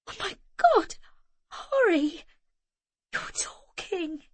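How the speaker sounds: high, trembling, fading to a slow hush; awestruck, barely holding it together; overwhelmed disbelief. A narrator's reading. Zoe astonished her silent nephew speaks.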